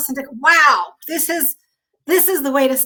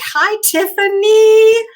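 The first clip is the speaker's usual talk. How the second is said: much higher, louder, drawn-out hello; delighted greeting; 9 semitones higher, 6 dB louder, much slower than usual. Real, not acted. Host greets longtime friend Tiffany, drawn-out delight. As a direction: sing out her name in delight.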